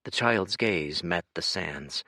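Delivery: ordinary delivery, nothing marked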